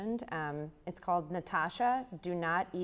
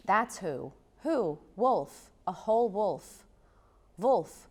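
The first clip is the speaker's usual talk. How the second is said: incantatory chant, louder on the refrains; poetry performance; 4 semitones higher, 6 dB louder, slower than usual. Acted. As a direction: chant the repeated lines like a rising spell. Poet performs her poem Wolverine.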